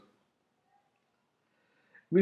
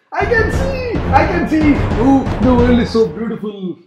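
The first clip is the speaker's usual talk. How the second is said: very high, louder joyful cry; overjoyed; 8 semitones higher, 8 dB louder than usual. Acted. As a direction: gush with hammed-up relief. Adi overjoyed.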